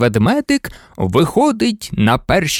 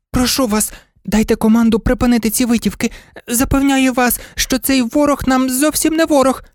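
high, pleading toy-dog voice; insistent little dog; 8 semitones higher than usual. A narrator's reading. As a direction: insist urgently in a tiny high voice. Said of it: "наполягав собачка". (тоненький наполегливий голос собачки)

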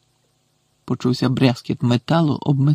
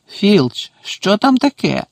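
higher-pitched, peremptory question; irked demand; 6 semitones higher, slower than usual. A narrator's reading. Angry professor calling up to the caretaker, demanding. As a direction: cut in with a raised, demanding pitch.

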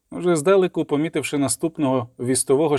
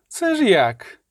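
high, drawn-out menacing question; ominous drawl; 7 semitones higher, much slower than usual. A narrator's reading. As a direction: draw the words out malevolently. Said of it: "зловороже тягнучи слова". (зловороже, тягнучи слова)

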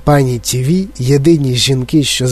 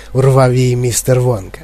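usual speaking voice

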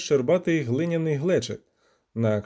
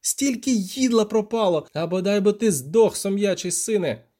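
high, aggrieved outburst; cursing cry; 6 semitones higher than usual. A narrator's reading. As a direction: lament and curse at the lost catch. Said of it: "закричав Любисток".

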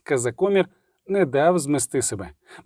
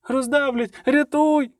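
very high panicked wail; shrieking; 11 semitones higher, slower than usual. A narrator's reading. Dandelion fears being crushed, shrieking. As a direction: squeal for rescue from the ground. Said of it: "верещав Любисток".

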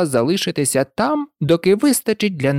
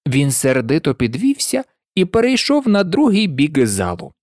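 usual speaking voice

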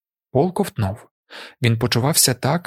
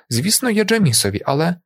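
raised pitch, left hanging; hesitant objection; 7 semitones higher than usual. A narrator's reading. Chani offers a dead man's plugs to the leader, hesitantly. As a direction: lightly voice her tentative excuse.

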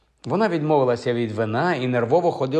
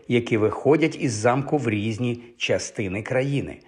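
ordinary delivery

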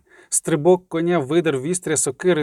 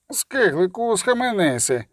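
raised voice, urging restraint; shouted warning; 4 semitones higher, slower than usual. A narrator's reading. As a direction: call out to rein in a comrade. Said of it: "крикнув хтось із хватів".

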